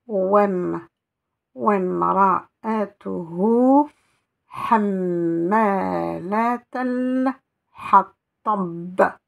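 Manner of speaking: slow recitation with drawn-out words; recited verse. Shown as a demonstration. Teacher rereading the verse for students, slow and recited. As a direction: chant the verse slowly and steadily. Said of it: "Alors, je relis ce verset".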